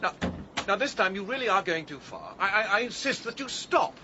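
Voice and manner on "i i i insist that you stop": higher and louder, putting his foot down; a flustered gentleman finally raising his voice; loud demand. Acted. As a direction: insist at full volume, flustered authority. Trying to stop Agatha.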